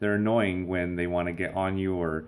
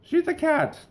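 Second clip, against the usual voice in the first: very high-pitched comic exasperation; mock outrage; 4 dB louder than usual. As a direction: squeal in exaggerated annoyance at the noise. Acted annoyance at yowling cat.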